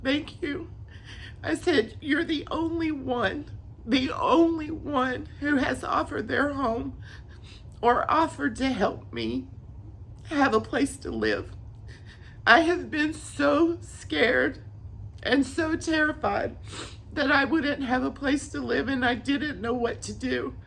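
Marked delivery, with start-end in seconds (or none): 0.0-0.7 high, soft, choked-up thanks; hushed thanks through tears; tearful gratitude. Retold or imitated. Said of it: "crying hysterically, and I'm just like". 2.0-20.5 choked up, high at first; retelling it on the edge of sobbing; tearful outpouring. Retold or imitated. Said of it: "crying hysterically".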